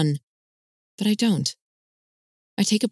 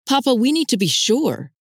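urgent protest; 7 semitones higher than usual. A narrator's reading. Son protests to father.

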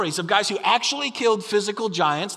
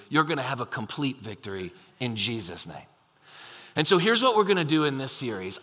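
ordinary talk, nothing marked

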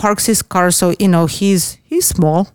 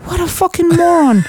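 exasperated disbelief; 9 semitones higher than usual. Real, not acted. Woman reacts to her date's silly question, exasperated. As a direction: marvel at his stupidity, pitch way up. Exclaiming over the man's silly reply.

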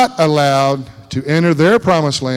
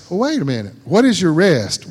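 normal talking voice

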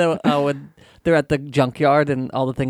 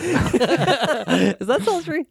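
laughing; 8 semitones higher, much slower than usual. Real, not acted. Laughing while asking.

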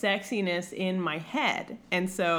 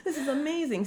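put-on childhood awe; 9 semitones higher than usual. Eight-year-old self reacting.

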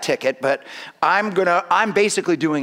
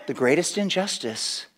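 soft, tender voice of Jesus; gently; 6 semitones lower, 5 dB quieter than usual. Retold or imitated. Jesus gently pleading with the offended listener, softer. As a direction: lay it down softly, like a gentle plea. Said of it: "And Jesus just kinda gently says".

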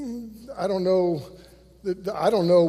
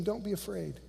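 gentle comfort; 13 dB quieter than usual. Acted. Jesus comforting the women.

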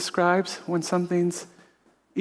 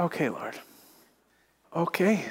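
soft, much lower resigned okay; hushed surrender; 3 semitones lower, 7 dB quieter than usual. Retold or imitated. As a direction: barely breathe it out, giving in. Yielding quietly to God.

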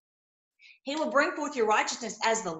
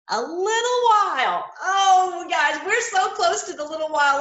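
excited shout; 6 semitones higher, 6 dB louder than usual. Real, not acted. Preacher exults over coming end.

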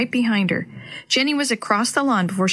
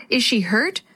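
ordinary delivery

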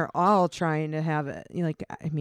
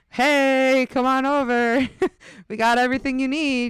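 higher, louder come-hither call; mock flirty call; 7 semitones higher, 4 dB louder than usual. The curvy friends calling the man over, loud and flirty. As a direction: beckon him over playfully. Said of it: "Us big fat fucks over here are like".

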